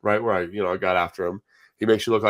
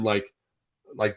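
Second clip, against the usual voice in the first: ordinary delivery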